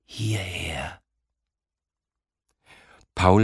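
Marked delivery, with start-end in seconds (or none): 0.0-1.0 quiet unvoiced whisper; an unseen voice whispering a command. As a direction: whisper it urgently, softer. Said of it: "Die leise Stimme verlangt".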